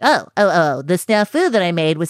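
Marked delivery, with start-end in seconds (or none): none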